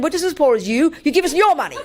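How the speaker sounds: high-pitched comic retold scolding; indignant lecture. Woman lecturing her robber about being poor, indignant. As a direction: turn the demand back, mock indignant. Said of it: "So I started giving him a lecture".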